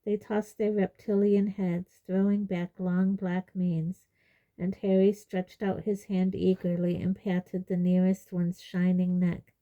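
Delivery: ordinary delivery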